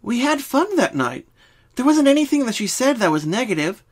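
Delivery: high, bouncy pony friend; Pinky voice. Acted. Pinky starts explaining her dreams. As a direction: answer like a cheerful pink pony.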